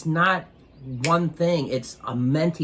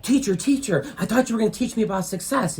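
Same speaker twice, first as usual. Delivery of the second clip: out of breath; 7 semitones higher than usual. Retold or imitated. Half-drowned teen begging his mentor, breathless and higher. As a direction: plead while still catching breath. Teen just pulled from water.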